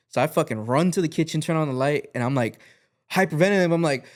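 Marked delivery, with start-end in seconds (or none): none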